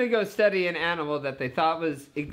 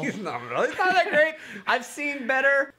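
unimpressed snowman voice; 11 semitones higher, 3 dB louder than usual. Pretend.

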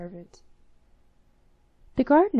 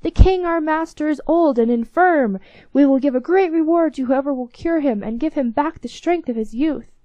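loud, high proclamation; heralds crying out; 6 semitones higher, 10 dB louder than usual. A narrator's reading. King's heralds announce a reward, loud raised cry. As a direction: proclaim it to the whole street. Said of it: "blowing all kinds of instruments and crying".

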